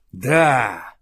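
short loud growled yes; growled reply. A narrator's reading. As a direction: bark it back like a bear. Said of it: "рыкнул медведь в ответ".

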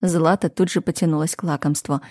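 plain reading voice

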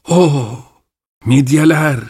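mild delighted cry, a touch shaky; an old man thrilled at a treat; trembling exclamation. A narrator's reading. Old grandfather seeing mussels, mildly delighted cry. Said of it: "diye haykırdı titrek sesiyle".

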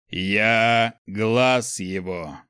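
slow, thunderous divine proclamation; booming voice. A narrator's reading. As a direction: boom each word out slowly like a herald of God. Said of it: "громогласно произнёс он".

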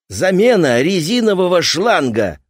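loud, high grumbling workman; grumbling repairman. Acted. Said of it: "пробурчал мастер".

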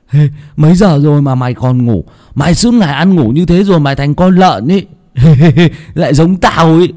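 put-on high, loud boyish voice laughing; teasing boy. Hưng teasing Tèo for sleeping, high laughing boyish voice. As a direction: tease your friend and laugh at yourself.

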